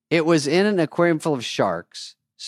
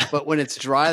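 ordinary talk, nothing marked

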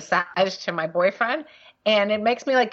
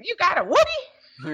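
much higher, slow, put-on voice of the masseuse; mimicking the masseuse; 11 semitones higher, slower than usual. Friend replays the masseuse's question, slow and much higher. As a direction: stretch it out high like the unbothered masseuse. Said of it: "the way she said".

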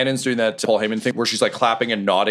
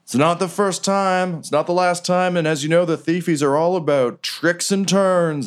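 high, booming fight-night announcer; ring announcer; 8 semitones higher than usual. Acted. GM as Riker opening the fight to the crowd, announcer voice. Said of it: "And he's like".

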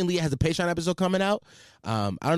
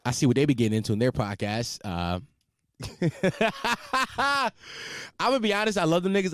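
ordinary delivery

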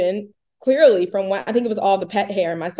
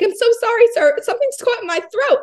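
much higher, sweet apologetic voice; overly polite apology; 13 semitones higher, 4 dB louder than usual. Retold or imitated. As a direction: play it innocent and sugary for the driver. Apologizing to the Uber driver.

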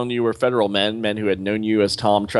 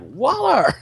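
shouted name, much higher; angry call. Acted. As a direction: barge in yelling her name. Rick Flag storms in.

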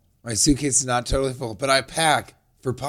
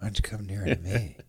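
low, hushed seductive croon; breathy, intimate romantic lead; warm smooth leading man; 6 semitones lower, 8 dB quieter than usual. Pretend. Crooning to a woman, pillow-talk.

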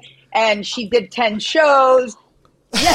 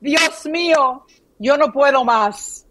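Spanish accent. Shown as a demonstration. Repeating the phrase for co-host, Spanish accent. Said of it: "say it one more time".

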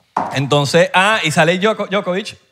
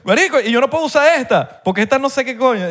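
acted quote; 8 semitones higher than usual. Nadal's retold complaint.

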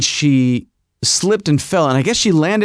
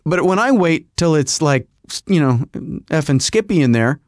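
plain reading voice

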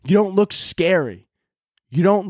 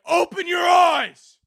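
mocking conspiracy poster; 11 semitones higher than usual. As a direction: yell it like a frantic online truther. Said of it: "people will be like".

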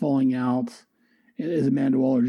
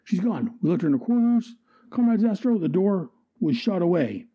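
high, panicked henchman reporting; frantic minion voice; 5 semitones higher than usual. Acted. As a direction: blurt it like a scared goon. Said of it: "henchman reports".

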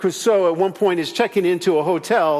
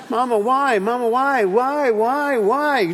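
put-on nagging child; 5 semitones higher, slower than usual.

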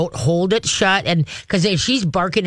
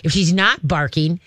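ordinary delivery

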